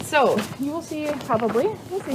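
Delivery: normal talking voice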